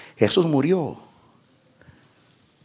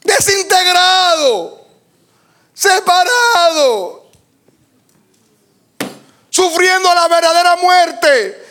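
fiery preaching; 5 semitones higher than usual. Acted. Pastor preaching to the church about separation, fervent and raised. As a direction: preach it out, building each phrase.

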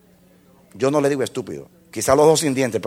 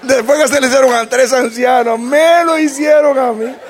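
real amused laughter while speaking; laughing; 11 semitones higher than usual. Real, not acted. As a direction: chuckle through it, much higher. Laughing at himself.

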